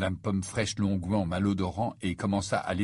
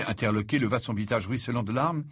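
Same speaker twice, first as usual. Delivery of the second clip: plain reading voice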